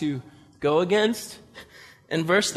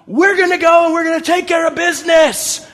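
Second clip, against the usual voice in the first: much higher, loud battle cry; rallying shout; 10 semitones higher, 8 dB louder than usual. Acted. Gideon rallying his army, loud battle cry. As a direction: fire up the troops. Rallying thirty-two thousand men.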